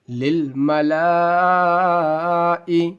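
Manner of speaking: للملائی chanted slowly on a level, stretched vowel; long madd. Shown as a demonstration. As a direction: hold the "laa" of للملائی very long, then a short "i". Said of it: "بڑی مد کو ہمیشہ کھینچ کر کے پڑھا جاتا ہے".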